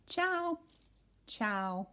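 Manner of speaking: loud, bright storybook read-aloud; reading to children. Acted. Teacher reading a picture book to kindergartners, loud and lively. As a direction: read it big for a class of kids.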